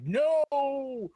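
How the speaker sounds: high, loud, drawn-out mock "no"; hammed-up cry of disbelief at the ending; retold cry of protest. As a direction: wail it like a dismayed film fan. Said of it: "I was like".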